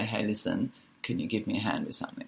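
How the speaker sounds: soft, quick and gentle asking for a hand; calm polite request. Shown as a demonstration. Teacher demonstrating the calm way to ask a parent, soft. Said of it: "You can say the exact same words, different tone of voice".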